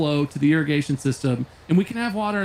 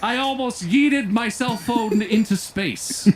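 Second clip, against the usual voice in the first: high, slow, emphatic venting; comic outrage with laughter breaking in; exasperated; 8 semitones higher, slower than usual. Real, not acted. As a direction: draw each word out in disbelief, pitched up. Exasperated venting about the phone call.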